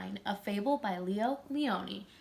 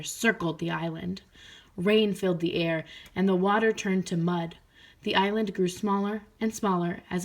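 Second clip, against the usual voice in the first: lively, louder storybook reading; reading to children; 8 dB louder than usual. A narrator's reading. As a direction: read it out to a class. Teacher reads picture book aloud.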